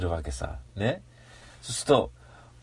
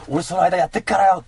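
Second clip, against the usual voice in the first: a cocky, louder hunter line; tough gamer voice; 4 semitones higher, 5 dB louder than usual. Pretend. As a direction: brag you'll handle it. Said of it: "player to cat companion".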